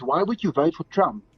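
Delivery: normal talking voice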